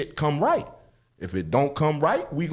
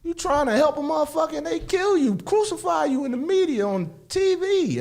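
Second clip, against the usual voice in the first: heated rant; 11 semitones higher than usual. Real, not acted. Venting about political attacks.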